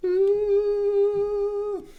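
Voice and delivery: very high thin squeak; high harmonic ooh. Shown as a demonstration. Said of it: "harmonics in my voice because I'm sick".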